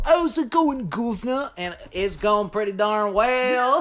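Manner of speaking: high, loud put-on British accent; hammed-up British greeting, pitched high; mock British accent. Mock British greeting, mimicking the clip.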